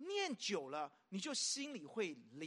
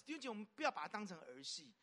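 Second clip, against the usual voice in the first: ordinary talk, nothing marked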